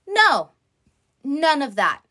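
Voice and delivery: ordinary talk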